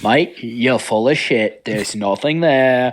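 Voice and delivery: very slow, drawn-out Aussie bloke; mock Australian accent. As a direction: lay on a lazy Australian drawl.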